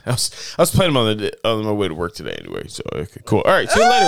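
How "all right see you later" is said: mock boss. Boss leaving, imitated.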